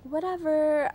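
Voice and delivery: a high, loud, bratty whatever. Pretend. Author mocking her own title, bratty whatever. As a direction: toss off whatever like a sulky teen. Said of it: "It's that bratty".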